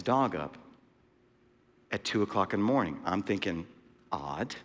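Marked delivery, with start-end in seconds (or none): 4.1-4.6 very low, soft aside; muttered thought. Retold or imitated. Man eyeing a night dog-walker, quiet inward remark. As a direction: drop it to a quiet note to self.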